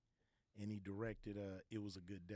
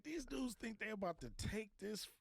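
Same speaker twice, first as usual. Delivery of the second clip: scoffing; 12 semitones higher than usual. Retold or imitated. Son scoffing at rivals.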